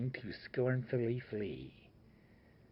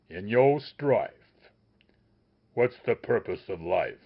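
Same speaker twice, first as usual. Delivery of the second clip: plain reading voice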